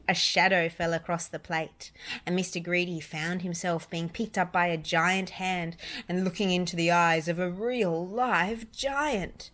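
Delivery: ordinary delivery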